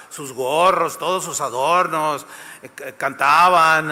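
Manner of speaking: ordinary delivery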